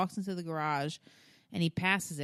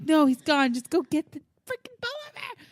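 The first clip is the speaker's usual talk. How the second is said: high-pitched retold pleading; frantic mock urging; exasperated urging; 10 semitones higher, 5 dB louder than usual. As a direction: push the kid on, voice climbing high. Urging panicked son back into garage.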